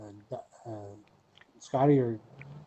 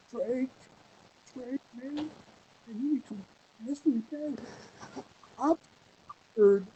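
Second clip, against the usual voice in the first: fake scared plea; 12 semitones higher than usual. Scotty on the phone begging Drake, high and mock scared. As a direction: pretend to be scared and stranded. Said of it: "And Scotty gets on there".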